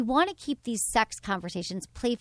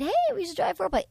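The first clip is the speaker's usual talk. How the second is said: light casual suggestion; 9 semitones higher, faster than usual. Retold or imitated. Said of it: "It's not like a light".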